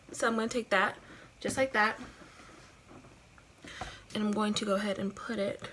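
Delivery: normal talking voice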